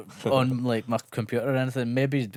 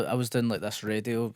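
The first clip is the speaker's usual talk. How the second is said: ordinary talk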